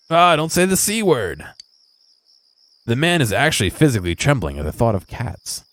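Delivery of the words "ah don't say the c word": high, strained recoil from the word; mock horrified groom. Groom begging player not to say cat, high mock horror.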